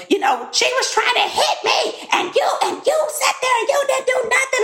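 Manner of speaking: high, whiny mocking imitation of a friend; mocking Simone. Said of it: "she's brought that up".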